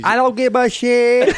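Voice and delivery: high, loud, slow brash defiance; defiant mom impression. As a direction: belt it out like a sassy mom.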